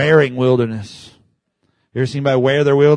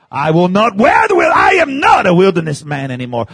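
louder defiant declaration; 6 semitones higher, 8 dB louder than usual. Real, not acted. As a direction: proclaim it with resolve. Declaring loudly.

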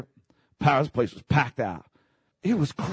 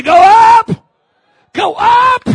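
very high, loud preaching call; leading a shout; 15 dB louder, much slower than usual. Acted. Preacher leading the crowd, shouting go up. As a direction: lead the whole room in the shout. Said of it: "Everyone say".

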